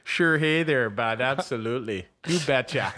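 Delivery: loud "hey there" and a hammy "you betcha"; Minnesota accent. Pretend. Host wrapping up the joke, Minnesota accent. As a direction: cap the bit like a Minnesota uncle. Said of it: "where did you get that accent from".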